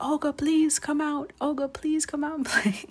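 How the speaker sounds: put-on high, loud small-child voice begging Olga; little son calling. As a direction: plead like an eager toddler at bedtime. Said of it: "He'd be like".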